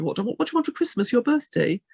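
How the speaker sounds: quick, high-pitched retold query; family member asking. Family voiced asking about presents.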